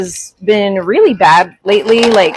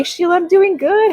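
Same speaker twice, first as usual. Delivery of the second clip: high bright hi and i'm doing good; upbeat hello to a viewer; cheery greeting; 10 semitones higher than usual. Real, not acted. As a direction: sing out a welcome.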